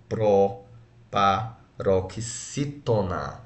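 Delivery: "proparoxítona" very slow, syllable by syllable; separado em sílabas. Shown as a demonstration. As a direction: draw out "proparoxítona" with pauses between syllables. Teacher spells out the term by syllables. ("proparoxítona" bem devagar, sílaba por sílaba)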